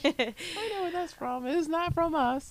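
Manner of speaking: high, far-off put-on voice, slowing on "I know where that's from"; mock smug DNA reveal. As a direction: play a snooty know-it-all reading her DNA results. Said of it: "I do want to do that and be like".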